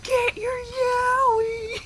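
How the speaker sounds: high, long drawn-out hawker's cry; stretched, piercing convention vendor call; loud vendor yell. Retold or imitated. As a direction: imitate an annoying seller yelling out wares. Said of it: "He'd just yell out yaoi".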